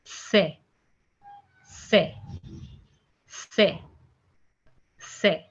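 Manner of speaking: "se" said the French way, say not see; "se" with a short French é sound; French letter C. Shown as a demonstration. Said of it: "the pronunciation of alphabet C in French".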